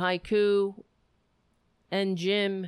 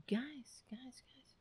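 whisper; 5 semitones higher, 15 dB quieter, slower than usual. Shown as a demonstration. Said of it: "Guys, guys (plain take)".

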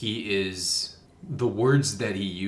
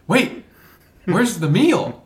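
high, loud baffled question; hammed-up confusion; mock surprise; 12 semitones higher, 11 dB louder, slower than usual. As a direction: play him baffled by the pie. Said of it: "he's like".

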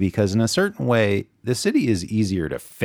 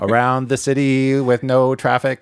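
a half-hearted Boston Kennedy speech voice; bad Boston accent; 4 semitones higher, 4 dB louder than usual. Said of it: "if I can do my bad Boston accent".

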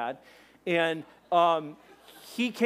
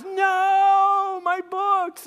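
long high mock wail of "no"; anguished yell; 14 semitones higher, 8 dB louder, much slower than usual. Friend watching backpack land in the river, anguished yell. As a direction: cry out in hammed-up despair. And he goes.

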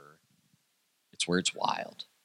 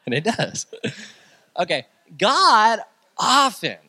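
emphatic preaching; 10 semitones higher, 8 dB louder than usual. Real, not acted. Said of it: "preacher raises voice emphatically".

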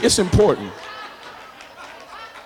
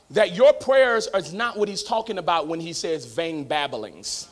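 ordinary delivery

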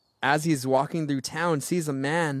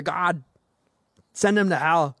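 a hammed-up plea to God, a touch higher and louder; mock vengeful prayer; 3 semitones higher, 3 dB louder than usual. Pastor mocking our unforgiving prayers, mock vengeful plea. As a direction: play the spiteful believer, half joking.

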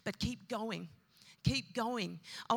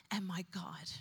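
whispered in awe; awed whisper; 3 semitones lower, 4 dB quieter than usual. Retold or imitated. Thomas kneeling before Jesus, whispering in awe. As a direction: breathe it out on your knees.

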